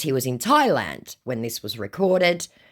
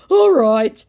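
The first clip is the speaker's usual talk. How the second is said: high, louder mock agreement; scammer's put-on voice; 11 semitones higher, 10 dB louder than usual. Acts scammer's reply.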